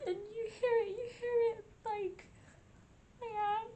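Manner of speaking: high, tearful voice breaking with sobs; real tears, fighting to keep talking; crying. Real, not acted. A vlogger moved to tears by the ghost's answer, crying. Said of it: "I mean, I'm already crying".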